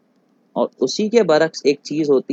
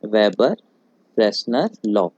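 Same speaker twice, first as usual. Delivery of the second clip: Weber, then a pause, then freshener लॉ, each word set apart and lower; slow deliberate naming; 6 semitones lower, slower than usual. Shown as a demonstration. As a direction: say weber and freshener लॉ as separate, careful chunks for notes. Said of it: "इसे हम लोग कहते हैं weber freshener".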